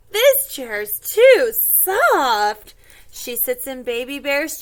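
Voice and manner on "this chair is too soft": slow, loud storybook grumble; dragged-out complaint. Acted. Goldilocks trying Mama Bear's chair, slow complaint. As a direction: stretch it out loudly in complaint.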